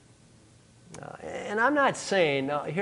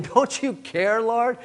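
rising, higher-pitched complaint to the Lord; a hurt, demanding question, pitched up at the end; indignant Martha; 6 semitones higher, slower than usual. Acted. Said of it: "Martha, rather indignant".

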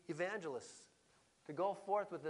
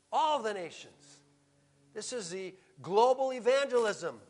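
raised, emphatic pulpit voice; forceful preaching; 5 semitones higher, 8 dB louder than usual. Real, not acted. Preacher to congregation on the nations, forceful preaching. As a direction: preach it out, landing hard on key words.

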